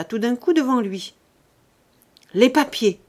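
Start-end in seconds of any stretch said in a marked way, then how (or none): 2.3-3.0 louder, strained demand; a mildly strangled outburst; choked, hoarse voice. A narrator's reading. Old father demanding his papers back from his son, choked. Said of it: "d'une voix rauque qui s'étranglait".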